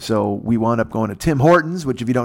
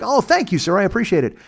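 grateful; 8 semitones higher than usual. Retold or imitated. So happy, thanking the agent.

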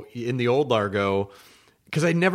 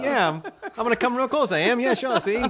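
high, squeaky dolphin chatter; 9 semitones higher than usual. Acted. Comic voicing a dolphin's constant agreeing, high chatter. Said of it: "dolphins always sound like they're going".

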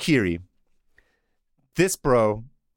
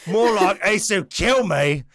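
higher, hammed-up British punchline; British accent; 6 semitones higher, slower than usual. Twin brother puns on his name, mock British. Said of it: "[name] has a British".

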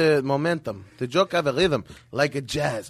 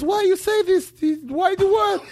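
shocked doctor. Acted. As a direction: demand an answer in shock. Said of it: "So he goes".

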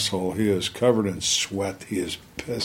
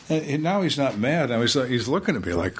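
ordinary talk

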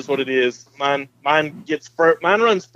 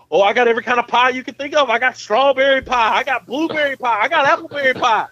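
hyped-up, fast, higher bragging voice; The Rock impression; 6 semitones higher than usual. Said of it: "I can hear y'all now be like The Rock".

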